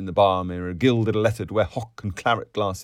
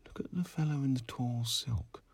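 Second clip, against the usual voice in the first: hushed, eager near-whisper, lifted in pitch; 7 semitones higher, 10 dB quieter than usual. A narrator's reading. Miss Douce points out a fellow to her friend, hushed and eager. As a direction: keep it excited but under the breath. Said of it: "Miss Douce said eagerly".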